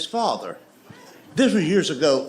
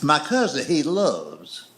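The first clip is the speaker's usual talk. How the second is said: usual speaking voice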